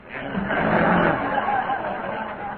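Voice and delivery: rising high laugh. Real, not acted. Laughs at the audience.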